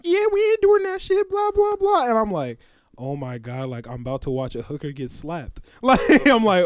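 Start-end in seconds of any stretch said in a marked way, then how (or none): 0.0-2.1 high-pitched sidekick squawk; hype girl. Retold or imitated. Girl behind her hypes her up, high mock voice. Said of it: "hyping her up". 3.0-5.6 low, soft mock dread; hushed aside. Bystander expects to watch a slap, hushed aside.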